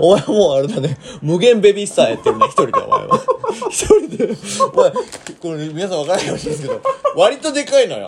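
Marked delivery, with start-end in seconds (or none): 0.0-5.0 laughing while teasing, pitch shooting up. Real, not acted. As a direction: crack up mid-sentence, voice climbing high. Teasing friend over snack binge. (笑いながらからかう)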